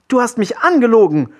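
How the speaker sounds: put-on high, loud angry boy; furious shout. A narrator's reading. Willi confronts the lying captain, shouting furiously. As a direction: shout the accusation, high and outraged. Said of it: "schrie er den Kapitän an".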